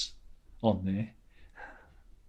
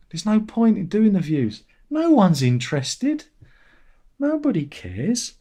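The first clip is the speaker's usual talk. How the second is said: higher, louder, slowed despair; exasperated; 8 semitones higher, 3 dB louder than usual. Real, not acted. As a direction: throw up your hands at nobody watching. Exasperated rant about views.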